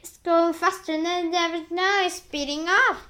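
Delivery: high, loud excitement; shouted. A narrator's reading. An aunt sees the peach speeding up, shouted. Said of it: "and Spiker shouted".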